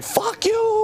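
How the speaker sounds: very high shouted curse; squealing mock outburst; mock shriek. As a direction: screech it at the MPs. Sergeant major going nuclear.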